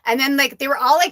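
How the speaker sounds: ordinary talk, nothing marked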